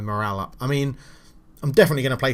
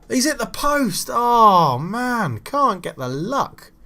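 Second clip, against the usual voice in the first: post groan; 10 semitones higher, 4 dB louder, much slower than usual. Real, not acted. Shot hits the post.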